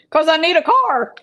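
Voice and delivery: high, loud kidding comeback; mock outburst. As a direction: blurt it out in pretend exasperation. Mock outburst, then "Just kidding.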